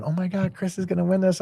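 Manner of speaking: high, excited voice imitating his kids. Father imitating his kids watching The Voice, high and excited. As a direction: mimic the kids cheering for Chris.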